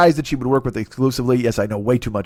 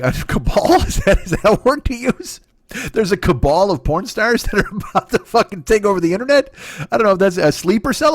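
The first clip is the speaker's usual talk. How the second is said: high, questioning, put-on disbelief; mock incredulity; 7 semitones higher than usual. Comic querying his own word cabal, mock-incredulous. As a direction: try out the odd word in a raised voice.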